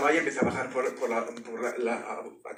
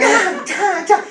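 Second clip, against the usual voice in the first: efecto de dibujos; 14 semitones higher, 9 dB louder, much slower than usual. Retold or imitated. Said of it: "Yo parecía Benji y Oliver".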